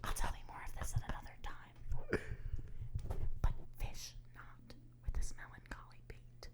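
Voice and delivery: whispered, unvoiced lines; stage whisper. Shown as a demonstration. Actor whispering the monologue's ending to a friend. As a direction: whisper it as a secret. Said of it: "Here's how I would do this one".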